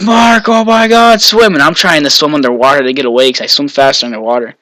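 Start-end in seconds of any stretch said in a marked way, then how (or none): 0.0-1.5 high, stretched-out mock scream; retold scream. Friend urges swimmer away from the dam, screaming. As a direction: imitate a frantic friend, pitched way up. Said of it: "my friend's screaming".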